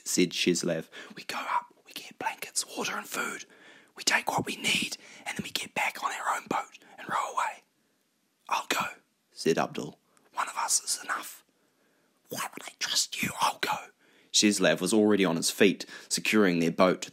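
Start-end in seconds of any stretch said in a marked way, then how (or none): 1.1-7.6 soft whispered plan; hushed conspiratorial whisper. Acted. Secretly planning to raid the yacht. 8.5-8.8 short hushed whisper; volunteer in a whisper; soft, secretive. Acted. Secret argument in the boat. 10.3-11.4 soft conspiratorial whisper; hushed and voiceless. Acted. Secret argument in the boat. 12.3-13.8 whispered challenge; hushed, soft retort. Acted. Secret argument in the boat.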